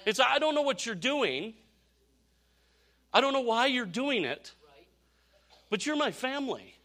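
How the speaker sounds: high, emphatic preacher with long pauses; impassioned preaching. Real, not acted. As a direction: drive the point home to the congregation. Preaches the point at full force.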